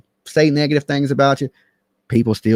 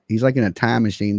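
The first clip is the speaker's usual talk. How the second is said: ordinary delivery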